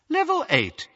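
high, cool announcing woman; cool witch's voice. A narrator's reading. Lift voice calls the floor, cool. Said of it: "said the witch's cool voice".

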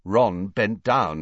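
plain reading voice

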